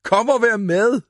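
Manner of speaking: high, loud happy call; joyful shout. A narrator's reading. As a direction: shout for everyone to join in. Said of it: "råbte han".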